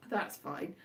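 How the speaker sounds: dry, dropped low and quiet; sarcastic. Real, not acted. Brushing off a viewer's comment, flat sarcastic mutter. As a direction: mutter it as if you don't mean it. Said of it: "that sounded very sarcastic".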